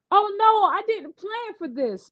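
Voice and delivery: put-on panicked girl. A narrator's reading. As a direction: caught off guard and worried. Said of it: "Sunny said".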